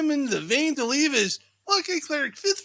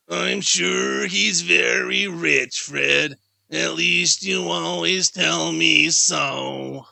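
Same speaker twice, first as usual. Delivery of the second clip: a lower, slower, louder wife's voice; low slow niece; 9 semitones lower, 5 dB louder, much slower than usual. Acted. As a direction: drawl it out as the scornful wife.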